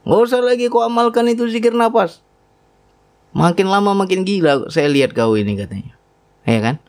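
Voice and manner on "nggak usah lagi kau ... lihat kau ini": angry wife. Retold or imitated. Wife angrily forbidding the breath remembrance, higher scolding voice. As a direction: scold him like the worried wife. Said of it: "Marah-marah istri saya".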